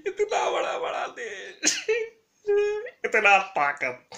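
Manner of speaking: anguished, half-shouted cry. Real, not acted. Lamenting to the world, crying out in anguish.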